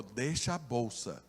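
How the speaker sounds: low, soft and slow gunman's order; quiet threat. Retold or imitated. Robber quietly ordering the pastor to leave the bag. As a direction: say it cold and quiet, like a gun is pointed.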